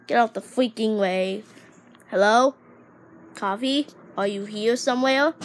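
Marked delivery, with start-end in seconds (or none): 2.1-3.9 loud call into empty room. Acted. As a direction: shout it out to find anyone. Calling out for someone.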